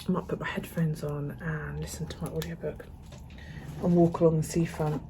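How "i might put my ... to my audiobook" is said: whispering. Real, not acted. Aside in unvoiced murmur.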